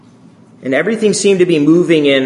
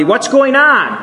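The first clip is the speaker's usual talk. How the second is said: much higher, a loud urgent question; asked rather loudly; 12 semitones higher, 3 dB louder, slower than usual. Retold or imitated. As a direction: call it out across the classroom. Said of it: "asked rather loudly".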